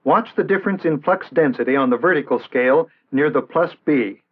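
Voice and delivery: normal talking voice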